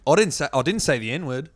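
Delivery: put-on Aussie accent; hammed-up Australian accent; mock Australian accent. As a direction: keep up a playful, punchy Australian voice. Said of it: "I was talking in different accents".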